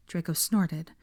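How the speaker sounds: ordinary delivery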